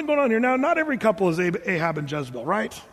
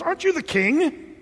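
mocking jab; 8 semitones higher than usual. Jezebel mocking Ahab.

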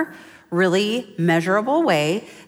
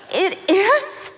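very high, hammed-up wail; mock-frantic mom; 12 semitones higher than usual. New mother protests to her mentor, mock-tearful panic.

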